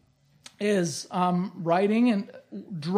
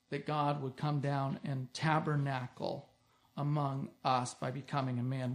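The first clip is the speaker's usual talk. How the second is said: solemn and quiet; 6 semitones lower, 11 dB quieter than usual. Acted. Preacher lowering his voice to the congregation, solemn and hushed. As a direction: hush the room for the weighty point.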